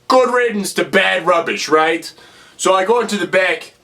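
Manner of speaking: normal talking voice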